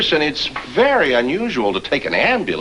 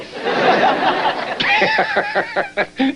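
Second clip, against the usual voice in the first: high, wild villain laugh; evil cackle. Acted. Doctor cackling at his own scary tonsil joke. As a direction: cackle like a mad scientist.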